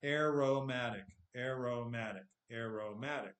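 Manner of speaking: AROMATIC with a clear RO like row; corrected pronunciation practice. Shown as a demonstration. Teacher drilling himself after the slip, aromatic with clear "ro". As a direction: repeat aromatic carefully, full "oh" in the second syllable. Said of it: "So I'm gonna practice it".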